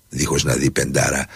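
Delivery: ordinary delivery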